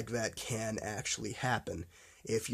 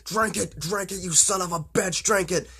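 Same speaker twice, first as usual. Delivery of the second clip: higher, pitched-up angry command, slowing into it; furious order; 6 semitones higher than usual. Retold or imitated. Scientist forcing the cure on Jacob, furious command. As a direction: force it on him, seething. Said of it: "the scientist guy gets really pissed".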